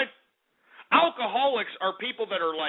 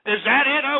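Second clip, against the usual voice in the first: usual speaking voice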